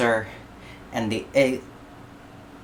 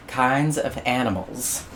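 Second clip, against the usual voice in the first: ordinary delivery, nothing marked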